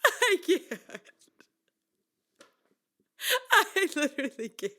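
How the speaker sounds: can barely get words out for laughing; high, squeaky words through laughter; helpless laughter. Real, not acted. Singer reliving the lisp article, laughing helplessly.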